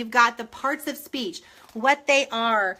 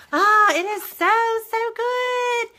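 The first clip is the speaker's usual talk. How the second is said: high, loud burst of delight; gushing excitement; 10 semitones higher, 4 dB louder than usual. Real, not acted. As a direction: squeal it out like a dream come true. Gushing over the writing book.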